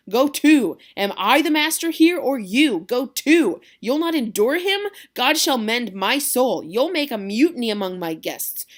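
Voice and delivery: loud, slow, high furious scolding; furious uncle scolding his nephew; angry Capulet. Acted. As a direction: roar at the insolent boy. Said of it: "Capulet gets a little angry".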